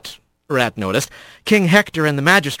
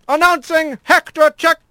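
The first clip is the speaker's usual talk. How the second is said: high-pitched royal announcement; herald's call; 13 semitones higher than usual. Acted. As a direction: proclaim the king's arrival in a high, ringing call. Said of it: "a call from behind stopped her".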